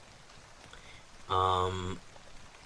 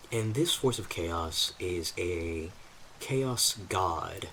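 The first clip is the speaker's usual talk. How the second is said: normal talking voice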